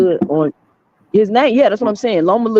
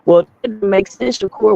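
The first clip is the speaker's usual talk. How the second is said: ordinary talk, nothing marked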